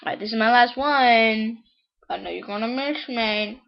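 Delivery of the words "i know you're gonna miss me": ordinary delivery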